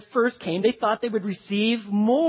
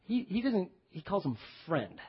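normal talking voice

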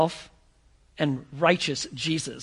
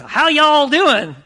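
slow, higher Texan drawl greeting; Texan accent; 8 semitones higher, 10 dB louder, much slower than usual. Retold or imitated. Texan visitor greeting New Yorkers, slow Texan accent. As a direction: greet them like a friendly Texan. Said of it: "you start talking to folks".

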